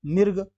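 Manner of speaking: मिर्ग as "mirg"; r before g. Shown as a demonstration. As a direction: put the r ahead of the g in मिर्ग. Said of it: "रॉ का उच्चारण पहले हो जाएगा".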